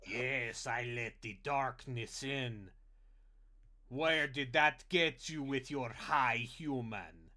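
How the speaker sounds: slow, loud booming troll growl; dopey cartoon bellow, drawn out. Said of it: "talking like a troll from World of Warcraft".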